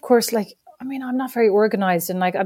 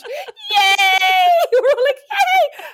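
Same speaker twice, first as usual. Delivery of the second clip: much higher, loud cheer; joyful cheer; 4 dB louder, much slower than usual. Retold or imitated. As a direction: cheer like the Brady Bunch. Family celebrating.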